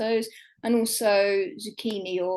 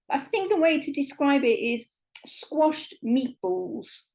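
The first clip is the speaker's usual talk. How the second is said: ordinary talk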